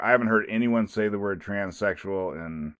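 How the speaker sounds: normal talking voice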